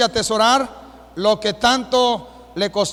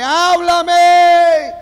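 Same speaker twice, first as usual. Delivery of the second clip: imitating a boy's loud desperate plea, higher; retold desperate cry; 7 semitones higher, 8 dB louder than usual. Preacher imitating a boy on the altar, shouting háblame.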